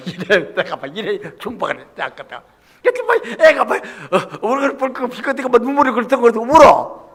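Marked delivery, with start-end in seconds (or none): 2.8-7.1 animated retelling. Retold or imitated. Describes her blushing and tearing.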